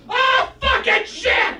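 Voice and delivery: loud, high, drawn-out yell; furious outburst shout. Acted. Student erupts before throwing desks, furious mock shout. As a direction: explode suddenly in a hammed-up rage.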